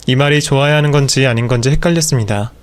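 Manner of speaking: ordinary delivery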